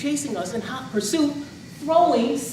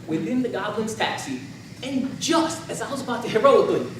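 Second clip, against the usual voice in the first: usual speaking voice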